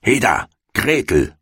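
impatient witch calling. A narrator's reading. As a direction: call the girl over like an impatient hag. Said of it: "rief sie dem Mädchen zu".

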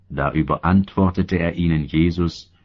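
ordinary delivery, nothing marked

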